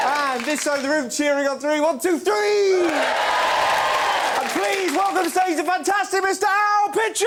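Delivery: high, loud, slow stage introduction; announcer shout. Real, not acted. Host announcing the next comedian, big shout. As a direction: bellow the guest's name onto stage.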